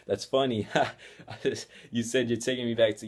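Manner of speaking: mock chuckling disbelief; laughing it off. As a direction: laugh as if it's surely a joke. Retold laughing off driver's threat.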